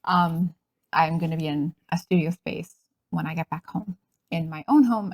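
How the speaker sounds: usual speaking voice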